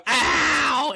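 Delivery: high, loud yelp of pain; Rey Mysterio pained yelp. Retold or imitated. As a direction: yelp like a wrestler getting hit. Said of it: "make fun of him with that voice".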